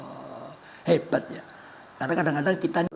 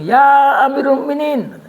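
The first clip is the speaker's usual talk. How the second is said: loud, slow, higher call; 8 semitones higher, 10 dB louder, slower than usual. Acted. Salman interrupts the caliph's speech, calling out loud.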